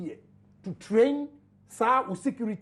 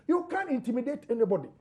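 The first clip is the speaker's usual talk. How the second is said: usual speaking voice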